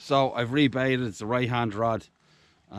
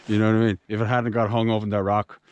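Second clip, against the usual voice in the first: ordinary talk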